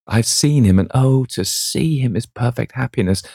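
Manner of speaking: high, bright joyful rush; rapturous. Acted. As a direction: gush with joy. Sybil's rapture over Dorian.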